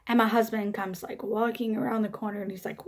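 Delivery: normal talking voice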